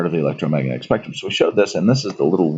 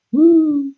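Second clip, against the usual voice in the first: cheerful woo; 5 dB louder than usual. Pretend. Cheer about radio.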